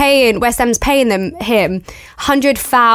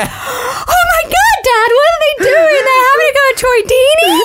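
put-on much higher, childlike shocked voice; shocked little girl. Telling a West Ham fan about her first match, as a shocked young girl. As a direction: play her younger self gasping to her dad. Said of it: "And I was like".

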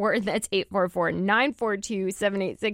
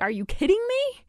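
pitched-up incredulous question; retold surprise; 13 semitones higher than usual. Retelling a first reading of the report, incredulous. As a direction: react in mild mock disbelief. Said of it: "was really a surprise to me".

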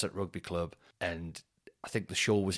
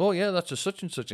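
higher-pitched mock know-it-all; know-it-all imitation; 9 semitones higher than usual. Mimicking people who know dog breeds, higher-pitched know-it-all. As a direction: lightly put on a know-it-all's voice.

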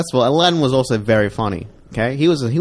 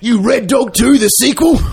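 shouting; 13 semitones higher, 8 dB louder, slower than usual. Pretend. Continues the mock shouted rant.

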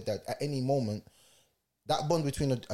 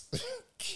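high laugh; 8 dB quieter than usual. Real, not acted. Laughs at own story.

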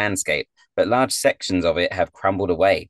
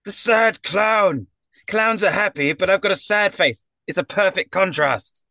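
very high, pleading clown voice; aggrieved performer justifying himself; offended clown; 11 semitones higher than usual. Acted. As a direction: explain the bit in a high whine. Voices the clown defending his act.

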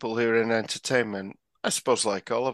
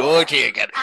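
silly puppet hippo voice; George from Rainbow; 3 semitones lower, 6 dB louder than usual. Pretend. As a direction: coo it like a timid children's puppet. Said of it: "I can do George and Zippy off Rainbow".

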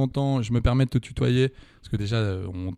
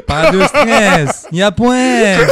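lightly voiced Gilbert Melki, louder and very slow; Gilbert Melki impression; 6 semitones higher, 8 dB louder, slower than usual. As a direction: draw the slogan out broadly like an actor. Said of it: "Alors là, c'était Gilbert Melki".

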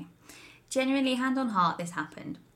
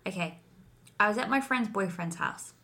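normal talking voice

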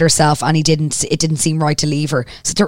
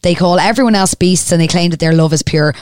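normal talking voice

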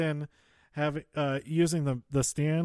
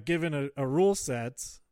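normal talking voice

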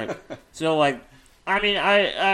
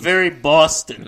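put-on Boston accent; 6 dB louder than usual. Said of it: "throwing you off with the accents".

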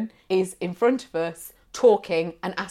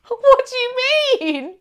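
laughing; 6 dB louder than usual. Real, not acted. Laughing while retelling confusion.

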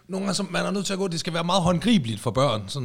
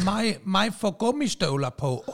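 put-on toddler voice; 4 semitones higher than usual. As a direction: talk like a three-year-old. Co-host voices small child.

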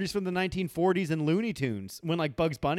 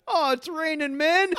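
much higher, slow mock whimper; whiny Homer; 10 semitones higher than usual. Homer begging the bartender for his song, high whiny voice. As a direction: plead like a sad cartoon dad. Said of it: "And Homer goes".

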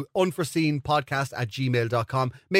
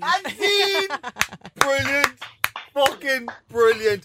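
excited cheer; 11 semitones higher, much slower than usual. Real, not acted. Cheering after the improv.

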